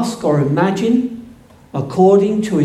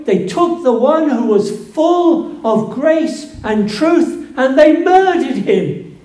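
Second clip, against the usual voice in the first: building to full force; 6 semitones higher than usual. Acted. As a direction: preach it up to a thunderous climax. Preacher's climax on the crucifixion.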